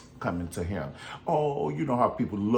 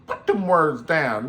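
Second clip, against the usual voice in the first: louder, slow scold; mock scolding; 6 semitones higher, 8 dB louder, slower than usual. Scolding singers holding lyric sheets, loud and slow. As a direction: bark it slow like a choir director.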